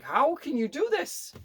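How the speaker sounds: high, slow amazed question. Retold or imitated. Waiter marvelling at the pianist in high amazement. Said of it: "And I'm like".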